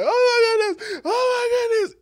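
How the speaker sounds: high, loud frantic wailing; panicked cries. Retold or imitated. Disciples on the rocking boat crying out high and loud in panic. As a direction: cry out in pure panic.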